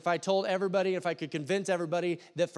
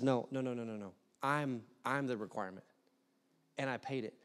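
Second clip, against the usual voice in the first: softer, calmer reassurance; gentle God; 5 semitones lower, 7 dB quieter than usual. Pretend. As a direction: hush them kindly, like a patient father. God gently answers the anxious believer.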